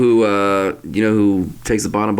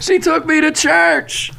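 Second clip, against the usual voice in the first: high slow mocking sitcom voice; slower than usual. Voicing sitcom character.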